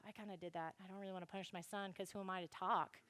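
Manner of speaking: soft, low, quick self-reproach; hushed, guilty admission; sheepish confession. Acted. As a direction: admit it quietly under your breath. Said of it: "King David's like".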